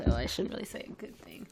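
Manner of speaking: ordinary talk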